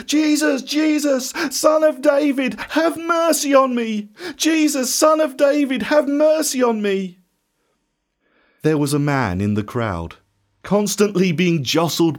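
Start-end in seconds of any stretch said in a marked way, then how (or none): 0.1-7.2 high, insistent pleading cry; even louder shout. A narrator's reading. Blind beggar refusing to give up, shouting to Jesus. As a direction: shout desperately for mercy, pitched right up. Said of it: "he called out even louder".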